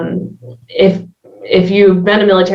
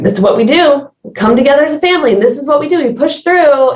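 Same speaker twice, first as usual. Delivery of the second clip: higher-pitched, quick rallying motto; imitated family pep talk; 7 semitones higher, faster than usual. Voicing the military family's mentality.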